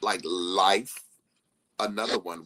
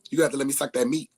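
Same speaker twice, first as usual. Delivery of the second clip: imitating her friend; 5 semitones higher, 5 dB louder, much faster than usual. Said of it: "she said".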